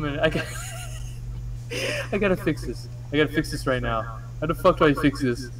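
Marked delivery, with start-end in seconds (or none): none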